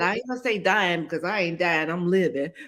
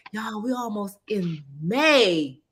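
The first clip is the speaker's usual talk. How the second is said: usual speaking voice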